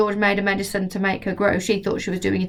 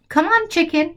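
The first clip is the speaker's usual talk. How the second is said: put-on coaxing boy; 9 semitones higher than usual. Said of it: "George said".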